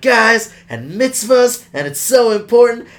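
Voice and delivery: high, loud, emphatic urging; earnest pep talk. Retold or imitated. Retelling dad teaching kids in the field, earnest pep talk. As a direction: play up a dad's rousing speech.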